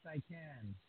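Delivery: distant, faint voice off the microphone; speaking far from the microphone; far from mic. Real, not acted. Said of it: "You sound a little, a little far".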